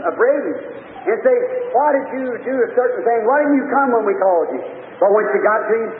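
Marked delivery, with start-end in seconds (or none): none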